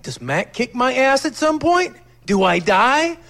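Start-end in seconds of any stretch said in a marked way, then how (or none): none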